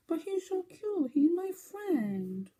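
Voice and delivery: high, slow, sing-song cooing at a puppy. Real, not acted. Knitter cooing at her knitted dachshund toy, high baby talk.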